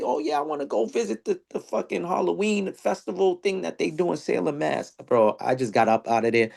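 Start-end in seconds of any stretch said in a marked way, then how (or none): 0.0-4.9 high, softer mocking eager tourist. Mocking someone eager to visit Salem, high and soft. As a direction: parody the clueless visitor.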